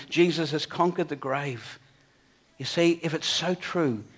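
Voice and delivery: ordinary talk, nothing marked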